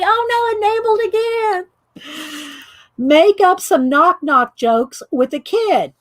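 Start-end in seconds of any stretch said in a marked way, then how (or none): 0.0-2.5 high, drawn-out mock cry; mock wail. Viewer Riri is greeted as an enabler, mock wail. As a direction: wail slowly in pretend dismay.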